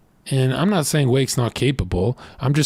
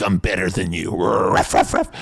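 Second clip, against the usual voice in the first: lower, gruff barking dog voice; excited dog; 6 semitones lower than usual. Retold or imitated. Dog barking at owner crouched low, gruff and lower. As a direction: bark it like a worked-up dog. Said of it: "like when you go low with your dog".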